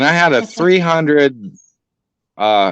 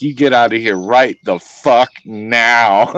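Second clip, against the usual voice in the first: voicing the moose. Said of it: "he's like".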